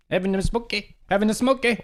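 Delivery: high, run-together Canadian "Having a smoke, eh?"; thick Canadian accent. Shown as a demonstration. Showing how the Canadian really said it, high run-together Canadian accent. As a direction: slur the words together, pitch way up, Canadian. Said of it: "It was just like".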